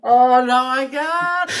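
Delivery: high, loud helpless laughter in the voice; can barely speak for laughing; cracking up. Real, not acted. Listener losing it at the joke's punchline, laughing helplessly.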